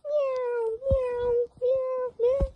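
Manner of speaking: high cat meows; meowing. Acted. Owner meowing back at his cat, high and soft. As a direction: meow like a kitten.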